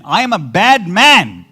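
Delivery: loud, high, drawn-out declaration; emphatic confession. Acted. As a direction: own up loudly, stretching the words. Acted confession of David in argument.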